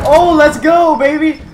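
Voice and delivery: loud excited cheer; hyped shout. Real, not acted. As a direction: yell it out, pumped up. Gamer hyped in a fight.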